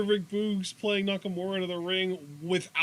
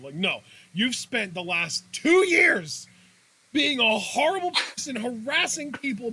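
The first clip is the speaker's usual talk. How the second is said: angry rant; 7 semitones higher, 4 dB louder than usual. Pretend. Ranting at Corbin.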